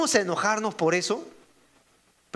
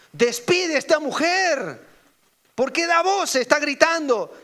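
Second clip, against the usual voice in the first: higher and louder, demanding she be sent away; impatient disciples; 6 semitones higher, 3 dB louder than usual. Retold or imitated. Preacher voicing disciples who want the woman sent away, impatient and raised. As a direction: voice the disciples shooing her off.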